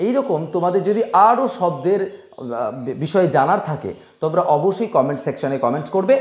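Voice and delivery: ordinary talk, nothing marked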